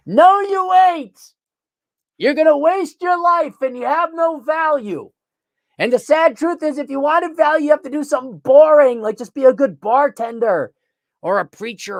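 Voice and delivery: high, loud scolding; loud, high-pitched exasperated lecture; exasperated rant. Real, not acted.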